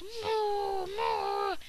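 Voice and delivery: mock mooing with a whine; crying cow. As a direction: moo like a sad little cow. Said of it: "he was all like".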